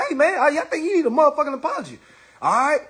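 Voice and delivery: usual speaking voice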